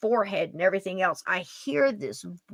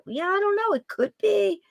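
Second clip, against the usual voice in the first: unsure inner voice; 11 semitones higher than usual. Retold or imitated. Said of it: "and I'm like".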